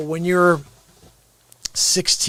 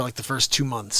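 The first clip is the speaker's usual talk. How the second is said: normal talking voice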